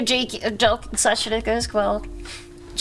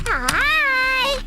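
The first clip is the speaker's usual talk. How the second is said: squeaky high greeting; high cheerful hi. Real, not acted. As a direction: chirp a bright hello. Greeting a chatter.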